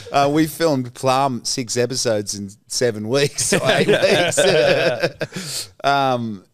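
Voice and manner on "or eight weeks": drawn out slowly through a laugh, higher; amused, laughing correction. Real, not acted. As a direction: stretch the words while laughing. Laughing at the schedule.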